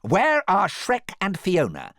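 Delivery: loud, high, slow demand; villain's drawn-out interrogation. A narrator's reading. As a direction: demand an answer imperiously. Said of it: "demanded Prince Charming".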